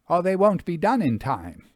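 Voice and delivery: busy farm wife, a little hurried; lightly voiced farm wife apologising over the pot; farmer's wife. A narrator's reading. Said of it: "she excused herself".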